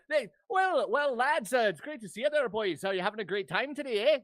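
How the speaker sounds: loud, high Irish-Canadian barkeep; hammed-up Canadian with an Irish lilt; Irish-Canadian accent. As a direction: greet them in a jolly Irish-Canadian accent. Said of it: "That's an Irish Canadian".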